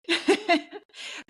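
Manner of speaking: real laugh. Real, not acted. Guest laughs at own joke.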